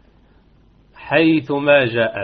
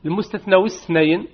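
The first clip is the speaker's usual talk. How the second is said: ordinary delivery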